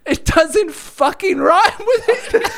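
high, slow, laughing complaint. Real, not acted. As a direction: protest through laughter. Laughing at bad rhyme.

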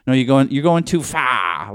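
mock Boston accent with mustard on it; hammed-up Boston imitation; overdone Boston accent. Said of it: "putting mustard on it".

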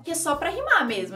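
usual speaking voice

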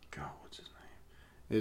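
slow, breathless whisper, hunting for a name; whispered to himself. Real, not acted. Co-host forgetting a name, whispering to himself. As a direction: murmur it under your breath as you try to remember.

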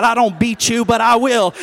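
loud, forceful threat; wrathful mom. Acted. Mom warning her defiant son, wrathful threat. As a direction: push every word out as a warning. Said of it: "wrath of God came over her face".

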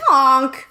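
imitated goose honk; comic goose call. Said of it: "It's like a goose".

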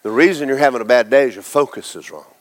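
usual speaking voice

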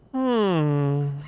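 loud thoughtful gnome hum; pondering gnome. Acted. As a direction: hum like a gnome over a book. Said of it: "it sounds like a gnome".

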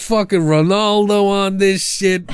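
high, slow, put-on New York tough-guy voice; mock New York accent. Mocking the filmmakers, in a put-on New York accent. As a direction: ham up a wiseguy accent. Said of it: "enough with the accent".

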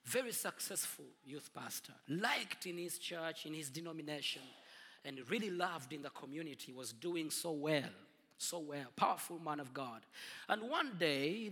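ordinary delivery

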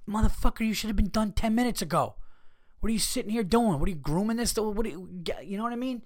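high-pitched mock outrage; exasperated rant. Imagined rant at slow groomer.

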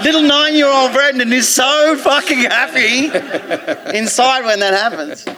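1 laughing. Real, not acted. Laughing recalling childhood joy.

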